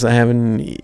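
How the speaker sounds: ordinary talk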